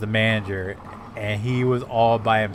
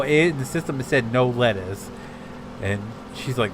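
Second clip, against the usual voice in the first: usual speaking voice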